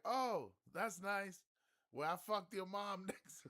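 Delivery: high, loud, slow yell breaking into laughter; mock yell, laughing. Guy answering the brag, slow loud laughing yell. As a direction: drawl it loud then crack up. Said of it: "He's like".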